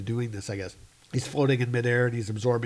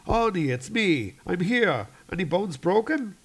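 higher, urgent comic-book rescuer; rescuer calling out; 7 semitones higher than usual. Acted. Rescuer finding an injured crewman, higher and urgent. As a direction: check on a trapped friend, pitched up. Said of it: "and he's like".

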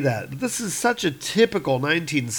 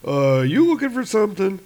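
a touch higher, suspicious cop voice; lightly voiced street cop; 7 semitones higher than usual. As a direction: eye the stranger warily. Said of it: "the cop is listening".

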